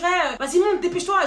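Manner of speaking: loud, high hurry-up command; imagined rough order. Retold or imitated. Imagined kidnapper ordering her into the car, loud and urgent.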